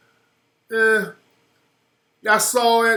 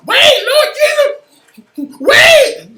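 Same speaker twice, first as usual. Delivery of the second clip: high-pitched, hammed-up scream; mock screaming; 9 dB louder, slower than usual. Onlookers in the back plead during a fight, mock screaming. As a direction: imitate a panicked onlooker shrieking from the back. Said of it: "Negroes in the back screaming".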